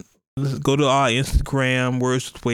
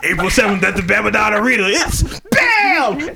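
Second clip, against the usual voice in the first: loud hype announcer shout; monster truck ad; 8 semitones higher, 5 dB louder than usual. Retold or imitated. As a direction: boom it like a stadium promo. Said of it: "old monster truck commercials".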